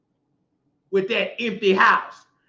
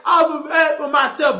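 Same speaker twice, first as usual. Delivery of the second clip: higher, loud mocking mimic; mocking quote; 6 semitones higher, 3 dB louder than usual. Preacher mimics a woman's boast, high and loud. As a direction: throw her claim back, pitched up and loud. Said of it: "How you say".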